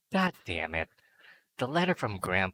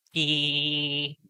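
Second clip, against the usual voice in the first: squeal; 7 semitones higher, 4 dB louder than usual. Real, not acted. Squeal after crude joke.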